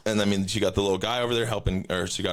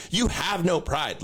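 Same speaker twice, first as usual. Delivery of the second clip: slow scolding; 8 semitones higher, slower than usual. Retold or imitated. Scolding the taco guy.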